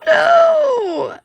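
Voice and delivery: high, delighted No! with a laugh; squealing no. Real, not acted. Seeing the sleeping puppy photo, squealing delight. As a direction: squeal No! at something too cute.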